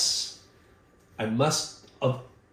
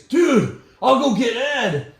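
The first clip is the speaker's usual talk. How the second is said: high, loud panicked exclamation; imitating alarmed friend; 11 semitones higher, 6 dB louder, slower than usual. Friend seeing the injured face runs for help, alarmed. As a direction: cry out in alarm, voice leaping up.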